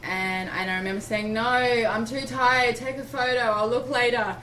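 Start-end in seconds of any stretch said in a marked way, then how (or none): 1.3-4.4 tired refusal. Retold or imitated. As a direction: wave her off, too tired to care. Refusing midwife while crowning.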